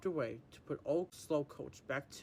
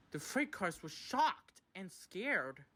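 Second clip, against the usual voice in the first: ordinary delivery, nothing marked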